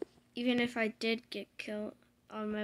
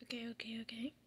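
whisper; 11 dB quieter, faster than usual. Real, not acted. Hushed okays while playing.

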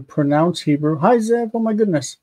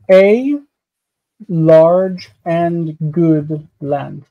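slow dictation; much slower than usual. Shown as a demonstration. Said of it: "slow model translation".

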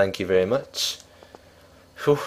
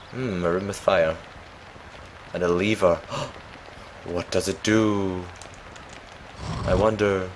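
silly put-on voice; 5 dB quieter than usual. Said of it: "I don't know why I'm speaking like this".

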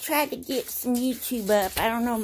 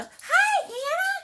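much higher cartoon voice; Mickey and Minnie voice. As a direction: chirp it like a cartoon mouse greeting Hannah. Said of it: "Mickey and Minnie go".

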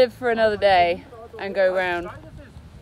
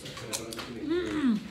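normal talking voice

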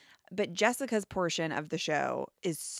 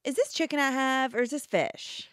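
ditzy question voice; 6 semitones higher, 3 dB louder than usual. Retold or imitated. Quoting Jessica's famous line.

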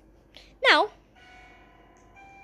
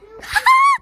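high shrieking laugh; 12 dB louder than usual. Real, not acted. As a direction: burst out in a high screaming laugh. Startled in creepy game.

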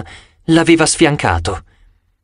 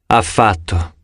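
dejected; 5 semitones lower, slower than usual. A narrator's reading. Grover contradicts the hero, dejected and heartbroken. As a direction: say it with no hope left. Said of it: "obiettò Grover, affranto".

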